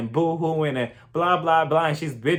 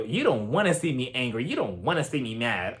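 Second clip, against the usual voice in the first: threatening; 4 semitones lower, 4 dB quieter than usual. Retold or imitated. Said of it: "Sutton is over here threatening".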